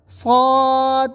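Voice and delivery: صاد loud and heavy, cut off without bounced d; heavy صاد with a stopped ending; wrong ending. Shown as a demonstration. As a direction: round the vowel of صاد but drop the final echo. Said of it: "یہ بھی غلط".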